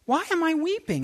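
high-pitched mock bewilderment; baffled at weeping. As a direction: question your own tears in comic disbelief. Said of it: "he realizes that he's weeping uncontrollably".

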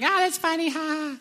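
very high, squeaky imitation of laughing; mock laughter. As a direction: imitate a table cracking up in a high voice. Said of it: "everyone else at the table laughed".

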